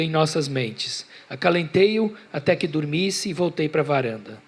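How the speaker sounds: measured, projected author's reading; literary story narration. A narrator's reading. Author reading his buffalo tale to an audience, story narration. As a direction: narrate with a lifted, deliberate literary cadence.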